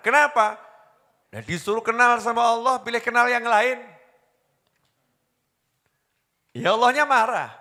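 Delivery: rising preacher's heat; preaching fervor. Real, not acted. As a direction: drive it home to the crowd. Preaching climax.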